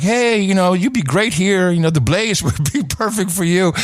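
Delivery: mock recruiter tempting him; coaxing pitch. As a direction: sweet-talk him into the job. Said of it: "they always like".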